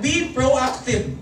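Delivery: Be proactive stretched out slowly; drawn-out Be proactive; slow slogan. Shown as a demonstration. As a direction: lay out each word of Be proactive. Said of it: "teaching a slogan".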